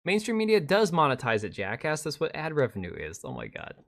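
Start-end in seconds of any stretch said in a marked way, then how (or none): 3.2-3.7 exasperated groan. Real, not acted. Groaning at the boring video.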